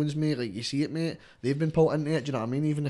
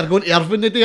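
mock colleague; 7 semitones higher, 11 dB louder, faster than usual. Coworkers asking, mimicked voice.

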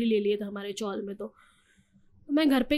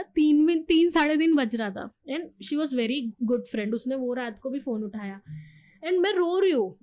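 ordinary talk

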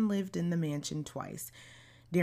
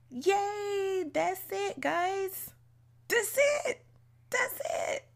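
squealing high and bright, much higher and louder; excited cheer; 3 dB louder, slower than usual. Real, not acted. Host cheers to her listeners at the end, giddy and high. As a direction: celebrate like the finish line is crossed.

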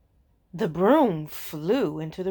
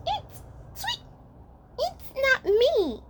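much higher, tiny timid rat voice; little rat voice. Acted. Pet rat denies the noise to the others, high tiny voice. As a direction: squeak it out like a nervous little rat. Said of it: "said the rat".

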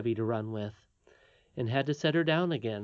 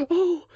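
high, loud gasped cry; frightened near-scream on a sigh; sigh almost a scream; 8 dB louder than usual. A narrator's reading. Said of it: "with a sigh that was almost a scream".

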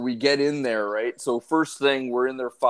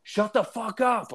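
much higher, slow mock drunk yell; more intense retold snap; 11 semitones higher than usual. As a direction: imitate him boiling over at the barking dog. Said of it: "he's getting more and more intense".

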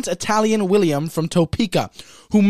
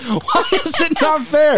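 laughing; 8 semitones higher, slower than usual. Real, not acted. Laughing at friend's complaint.

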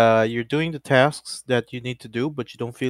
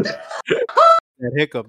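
laughing hard; 5 dB louder, much slower than usual. Real, not acted. Cracking up at a hiccup.

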